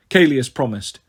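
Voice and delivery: usual speaking voice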